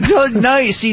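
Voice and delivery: ordinary delivery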